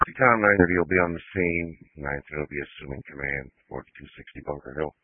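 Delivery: tinny radio transmission; police radio. Real, not acted. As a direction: voice over a dispatch radio. Fire unit radio transmission.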